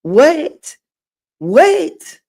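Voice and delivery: ordinary delivery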